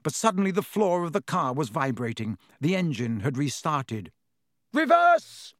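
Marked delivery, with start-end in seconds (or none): 4.7-5.5 very high, loud yell. Acted. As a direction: bark the order at full volume. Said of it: "Harry yelled".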